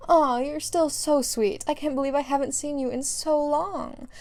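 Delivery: high, drawn-out fond coo; gushing affection. Acted. Mother to her son's old friend, gushing. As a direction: coo over a dear child long unseen.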